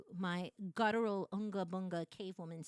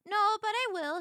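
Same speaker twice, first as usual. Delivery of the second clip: high, loud, cheeky promise; playful high reply; 12 semitones higher, 9 dB louder than usual. Retold or imitated. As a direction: toss it back high and cheeky. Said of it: "And I was like".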